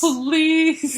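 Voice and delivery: high, stretched call, only half shouted; hammed-up high-pitched yell; mock yell for help. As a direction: echo a comic cry for the police. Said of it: "this woman's yelling for police".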